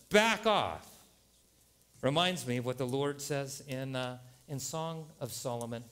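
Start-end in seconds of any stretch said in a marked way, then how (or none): 0.1-0.9 high, loud, falling command; protective warning. Acted. Preacher repeats Jesus's warning to the critics, forceful. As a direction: warn them away forcefully.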